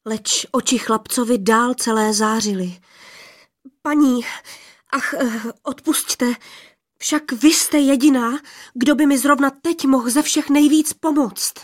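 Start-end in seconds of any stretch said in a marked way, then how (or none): 3.8-11.5 higher, louder anxious plea to the lady; anxious pleading. Acted. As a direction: beg her for help, afraid of muddling it. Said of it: "A celý ze strachu ... celý z úzkosti". (úzkostná, vyšší a hlasitější prosba)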